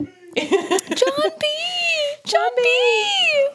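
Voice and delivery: very high mocking name call; high squeaky parody. Echoing the parody.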